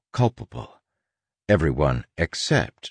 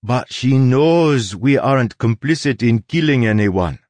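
higher, louder, put-on character voice; insistent Soleil; 6 semitones higher, 5 dB louder than usual. Soleil argues to the crew that Tress is a mask, insistent.

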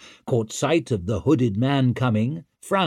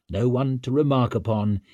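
usual speaking voice

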